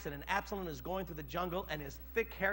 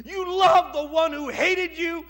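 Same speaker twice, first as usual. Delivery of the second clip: stern shout; 10 semitones higher, 14 dB louder, slower than usual. Acted. Joab's rebuke continues.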